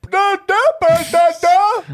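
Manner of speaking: high, loud nonsense syllables; mocking dumb voice. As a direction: mimic the dopey voice with blaring da-das. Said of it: "the worst dumb accent when you did him".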